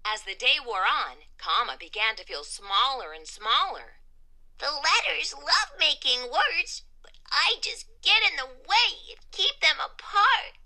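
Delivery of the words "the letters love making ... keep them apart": high, small character voice; dejected self-talk. A narrator's reading. Comma feeling smaller and smaller, mildly dejected high voice. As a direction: voice it as discouraged talk to oneself. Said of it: "he whispered to himself".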